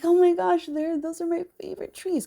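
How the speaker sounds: high, loud burst of delight; excited recognition. Retold or imitated. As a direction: gasp it out like spotting an old friend. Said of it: "And I'm like".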